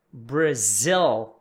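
"Brazil" drawn out, stressed "zil" with a dark final l; English BRAZIL. Shown as a demonstration. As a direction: say "Brazil" as "bruh-ZILL", slowly and falling. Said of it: "Presta atenção na pronúncia de Brazil". ("Brazil" em inglês, com l no final)

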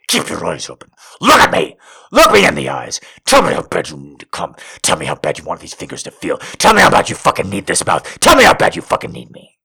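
fast, pressing, insistent orders; dominant commands. Acted. Lover demands she keeps looking, fast insistent commands. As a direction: stack the demands, building urgency.

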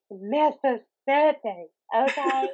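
slow, pointed, mildly indignant correction; a mock-offended correction, slow. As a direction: draw out Mississippi like setting them straight. Correcting people about her home state.

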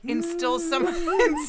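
high, laughing struggle to speak; real laughter breaking up the words; tickled giggling. Real, not acted. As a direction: try to get the word out through giggles. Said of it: "tickling my neck while saying that".

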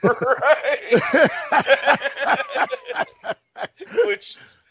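bursts into high laughter; cracking up at the irony; laughing so hard the words barely come out. Real, not acted. Reacting to absurd movie detail.

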